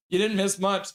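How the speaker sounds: ordinary talk, nothing marked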